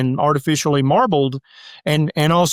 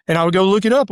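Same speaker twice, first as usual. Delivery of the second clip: usual speaking voice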